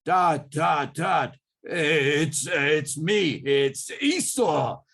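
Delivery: loud put-on voice of the brother; faked Esau voice. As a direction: disguise the voice to pass as Esau. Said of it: "Jacob put on his default".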